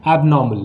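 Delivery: एबनॉर्मल as ab-NAW-mul; correct pronunciation. Shown as a demonstration. Teacher drilling what we will say, correct pronunciation. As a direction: say एबनॉर्मल with a silent r. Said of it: "क्या कहेंगे?".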